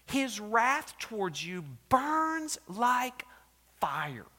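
loud, high, slow thundering line; thunderous quote. Retold or imitated. Preacher quoting Edwards, thunderous. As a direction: boom it out. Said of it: "He said".

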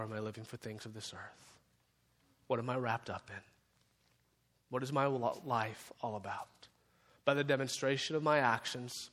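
low, soft pastoral altar call; hushed prayer. Real, not acted. Pastor guiding bowed heads to pray to God, hushed and low. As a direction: lead them quietly into prayer, with long pauses. Leads closing reflection and prayer.

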